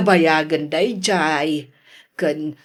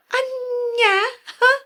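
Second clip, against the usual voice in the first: high, wailing shock; shocked cry. Acted. Old woman shocked at the news, high wail.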